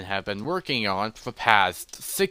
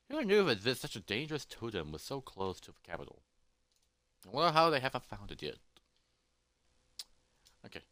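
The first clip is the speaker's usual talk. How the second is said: soft, quick aside to oneself; hushed private puzzlement; quiet musing; 11 dB quieter, faster than usual. Acted. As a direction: wonder under your breath. Hero muses to himself.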